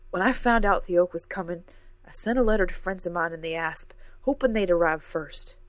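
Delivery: damn it, you gave me no choice; upset defense. A narrator's reading. Professor defends herself to Cora, loud and heated.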